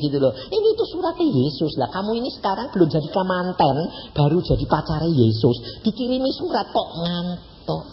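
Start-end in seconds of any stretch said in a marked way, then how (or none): none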